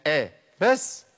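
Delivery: آ cut short after one beat; a single short beat on آ; one-count vowel. Shown as a demonstration. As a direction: say آ as a short a, then stop. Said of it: "الحركة زمانها حركة واحدة".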